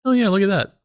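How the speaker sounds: high goofy imitation of David; David impression. Teasing David by imitating his younger self, high. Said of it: "it'd be David going".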